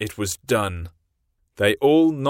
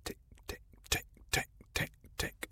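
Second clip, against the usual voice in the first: soft whispered tick tick; ticking clock; 16 dB quieter, faster than usual. A narrator's reading. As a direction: mimic a distant clock. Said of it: "he stopped and listened".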